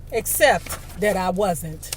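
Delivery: ordinary delivery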